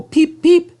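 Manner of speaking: very high, loud peeps; a cheery toot going by; cheerful whistle. A narrator's reading. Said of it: "with a cheerful".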